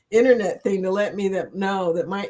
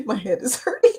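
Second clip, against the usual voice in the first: laughing hard; 6 semitones higher than usual. Real, not acted. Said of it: "You guys made me laugh so hard".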